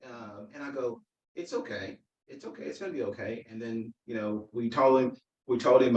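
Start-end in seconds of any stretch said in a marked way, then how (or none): 1.3-3.4 very soft, soothing retold reassurance; reassuring. Dad calming son about losing, soft and reassuring. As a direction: comfort a child very quietly.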